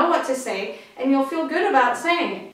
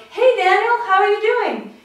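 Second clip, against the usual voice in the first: high, loud, delighted hello to a loved one; friendly greeting; 8 semitones higher, 6 dB louder than usual. Shown as a demonstration. Greeting a brother on a walk, friendly. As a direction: greet a friend brightly, pitched up. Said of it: "the way we use our tone of voice when talking to a friend".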